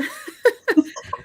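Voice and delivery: high tearful laugh; nervous laugh. Real, not acted. Host praising her friend, laughing through emotion. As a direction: laugh to hold back the tears. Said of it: "I hope I don't get emotional here".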